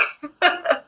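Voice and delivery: short high chuckle; light real laugh; quick giggle to round off; amused laugh. Real, not acted. Laughs after naming the host.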